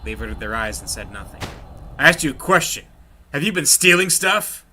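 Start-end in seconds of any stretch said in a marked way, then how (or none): 2.0-4.6 raised, louder voice pressing for an answer; higher, louder angry interrogation; angry demand. Acted. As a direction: demand the truth from the two. Gang leader interrogates his men.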